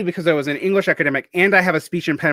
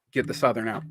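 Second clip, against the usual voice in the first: usual speaking voice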